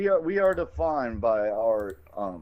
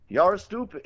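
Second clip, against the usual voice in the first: high, louder imitated Indian accent; mock Indian accent; 9 semitones higher, 5 dB louder than usual. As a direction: scold in a put-on Indian accent. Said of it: "do my whole Quickie Mart thing".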